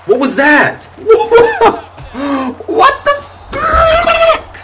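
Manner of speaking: very high, loud, stretched shriek with laughter; shrieking disbelief. Real, not acted. Gamer shrieking at an absurd play on screen. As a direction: scream it out in laughing outrage.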